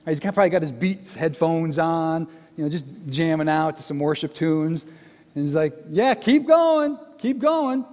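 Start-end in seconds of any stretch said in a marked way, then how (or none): 5.9-7.8 drawn-out, high, slow, lazy encouragement; trash talk. Retold or imitated. Elijah, lounging, eggs on Baal's prophets in slow, high mock encouragement. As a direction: cheer them on like it's a show from a recliner. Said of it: "just throwing down some trash talk".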